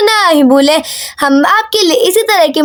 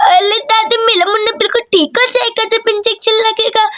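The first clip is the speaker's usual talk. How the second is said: put-on childish baby-talk lisp; small child babbling to granny; lisping little girl. Daisy answers about the baby.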